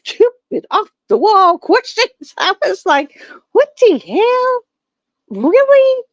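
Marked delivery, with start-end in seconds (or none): 3.5-6.0 much higher, slow, disbelieving; incredulous disbelief. Retold or imitated. Reacting to off-the-wall test questions, high incredulous disbelief. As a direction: sound floored by the stupid questions.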